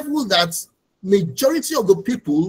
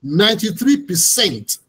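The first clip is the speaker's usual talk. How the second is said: usual speaking voice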